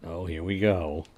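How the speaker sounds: ordinary talk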